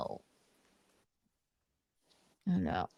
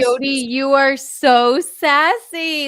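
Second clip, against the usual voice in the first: sassy praise; 4 semitones higher, 5 dB louder, slower than usual. Real, not acted. Greeting Jody on video call.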